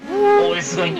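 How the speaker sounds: high-pitched startled cry; shocked exclamation. Real, not acted. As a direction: gasp out the shock. Reacts to crash.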